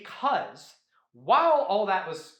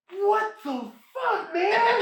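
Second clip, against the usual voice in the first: much higher, slow, laughing mock outrage; shocked disbelief; 12 semitones higher, 3 dB louder, much slower than usual. Matoro reacting to the crabs devouring each other, high laughing shock. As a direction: squeak out the shock through laughter. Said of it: "And Matoro's like".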